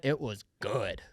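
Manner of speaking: low, emphatic GOOD; savouring. Real, not acted. Host recalling his pricey lasagna dinner, savouring it. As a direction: drop the voice low and soft on "good".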